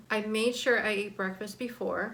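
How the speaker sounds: normal talking voice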